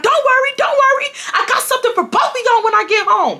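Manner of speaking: very high, ominous mother voice; threatening mom. Retold or imitated. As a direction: promise punishment like a mother.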